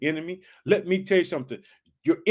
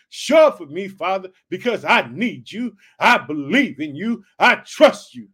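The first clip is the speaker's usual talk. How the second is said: pleading prayer; 6 dB louder than usual. Acted. David testifying to God, building to loud pleading. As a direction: cry out harder with each line.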